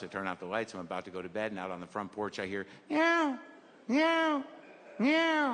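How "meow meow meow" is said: high, thin kitten mews; kitten meowing. Retold or imitated. Stray kitten on the porch mewing, high. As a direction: mew like a tiny stray kitten.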